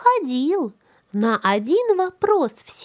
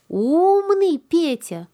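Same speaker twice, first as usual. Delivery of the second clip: put-on praising girl; 5 semitones higher, slower than usual. Granddaughter praising.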